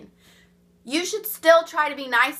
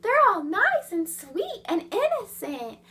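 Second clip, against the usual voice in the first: higher, lightly mocking sugary tone; mock sweet innocent voice; 7 semitones higher than usual. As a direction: parody their sweet innocent act, higher. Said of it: "And they say in this tone".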